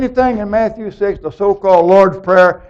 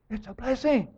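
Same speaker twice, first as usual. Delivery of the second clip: soft echo; 4 semitones higher, 13 dB quieter than usual. Real, not acted. Preacher reassuring the congregation with a soft repeated echo. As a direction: say It's a blessing again much softer.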